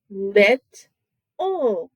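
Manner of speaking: "lit, ul" as two separate beats; teacher splitting the word in two; syllable by syllable. Shown as a demonstration. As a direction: pause between "lit" and "ul". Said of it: "That's how I say this word".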